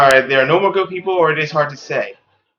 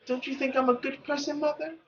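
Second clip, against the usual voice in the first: put-on higher and much softer, a small girl; timid girl; 11 semitones higher, 10 dB quieter than usual. Daughter asking her mother if she's good, higher and hushed. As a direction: ask it meekly, almost afraid.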